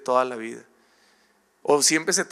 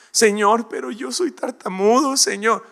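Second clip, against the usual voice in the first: much higher pleading Moses; whiny plea; 8 semitones higher than usual. Acted. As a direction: beg the Lord to send someone else.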